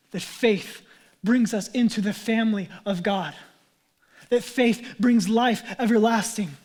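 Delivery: loud, emphatic preacher's declaration; impassioned preaching. Real, not acted. Preacher proclaiming faith to the congregation, loud and emphatic. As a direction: build in force with each phrase.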